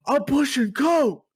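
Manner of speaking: loud slow yell; mock deadpan yell of a dealer. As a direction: bellow it out deadpan. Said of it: "he literally yells".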